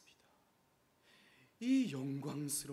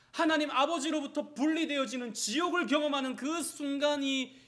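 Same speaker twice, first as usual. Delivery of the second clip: higher and louder, impassioned; fervent preaching; 10 semitones higher, 10 dB louder than usual. Acted. Pastor describing Jesus' suffering, impassioned preaching. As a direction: make them feel the cost.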